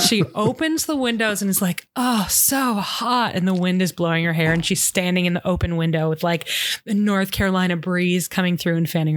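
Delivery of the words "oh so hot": slow sigh. Acted. Claire at the window.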